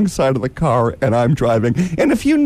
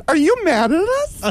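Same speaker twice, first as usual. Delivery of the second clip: mock innocent high voice. Mocking the angry cabbie.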